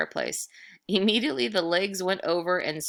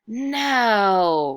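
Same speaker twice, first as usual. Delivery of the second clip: louder, higher booming ghost; ghost roar; 5 semitones higher, 7 dB louder than usual. Acted. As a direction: boom it out at the boy. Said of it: "roared the figure".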